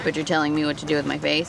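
annoyed woman voice. Acted. Skit, woman.

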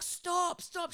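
high desperate stop; trying to halt her; pleading stop. Retold or imitated. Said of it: "I was like".

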